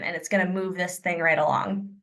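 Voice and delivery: ordinary talk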